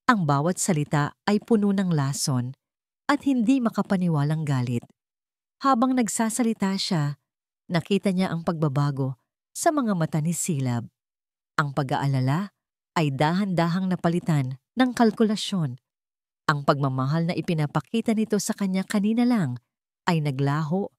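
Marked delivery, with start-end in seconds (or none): none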